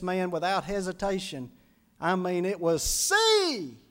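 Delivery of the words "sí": high, loud "See!" as the villager says yes; a joyful foreign "See!"; man's "si" for yes. Retold or imitated. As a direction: burst out "See!" like the eager convert. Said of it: "That meant yes".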